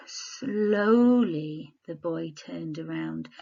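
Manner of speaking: very slow, drawn-out reading; slow suspenseful read. Shown as a demonstration. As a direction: stretch "Slowly" out to build suspense. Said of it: "a dot, dot, dot, an ellipsis on this sentence would add suspense".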